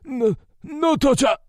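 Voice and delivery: high, loud panicked plea; yelled plea. A narrator's reading. Captive begging the soldier not to torture. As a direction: beg at the top of the voice. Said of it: "the man yelled in broken English".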